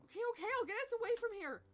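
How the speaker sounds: fast, loud, high rush of words; rapid, panicky agreement; flustered hurry. Acted. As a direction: blurt it out under pressure. Hurried reply to urgent demand.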